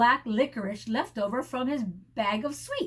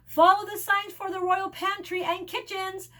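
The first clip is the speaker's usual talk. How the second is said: lightly voiced goose, a touch higher; goose giving directions; 7 semitones higher, 3 dB louder than usual. A narrator's reading. Goose sending passengers to the kitchens. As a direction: direct them like a butler. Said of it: "Percy said".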